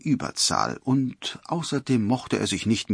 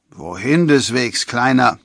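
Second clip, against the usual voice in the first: louder tipsy forester calling out; 3 semitones higher, 7 dB louder than usual. A narrator's reading. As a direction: call out as if a bit drunk. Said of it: "nicht mehr ganz nüchtern".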